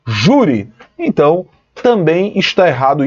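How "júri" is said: "júri" with the weight on first-syllable JU; JÚ-ri, first syllable stressed. Shown as a demonstration. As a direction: lean on JÚ in "júri", then fall away. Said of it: "a sílaba tônica de júri é ju".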